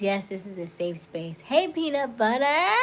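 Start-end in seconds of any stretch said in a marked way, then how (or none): 1.4-2.7 loud, high called greeting; calling out. Real, not acted. Host greeting a viewer in the live stream, loud high call. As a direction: call out to a friend, pitched up.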